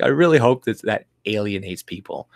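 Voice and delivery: ordinary talk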